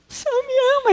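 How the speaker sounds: high, wondering voice; breathless disbelief at good news; amazed realization. Retold or imitated. Man hearing God loves him. (espanto maravilhado)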